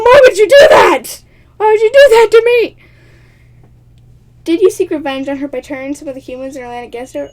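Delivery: ordinary delivery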